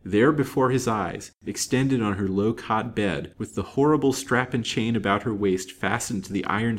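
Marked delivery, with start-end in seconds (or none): none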